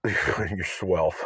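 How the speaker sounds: laughing through a flubbed word; laughing at a slip. Real, not acted. Vlogger laughing at his own flubbed word, giggling.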